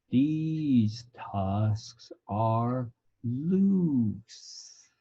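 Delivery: "These tusks are loose" stretched out frame by frame, very slow; slow motion. Shown as a demonstration. Teacher recalling the moment frame by frame, words in slow motion. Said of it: "everything started happening frame by frame".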